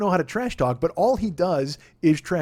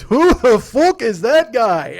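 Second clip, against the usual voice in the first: McGregor put-down; 7 semitones higher, 10 dB louder, much slower than usual. Retold or imitated. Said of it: "he goes".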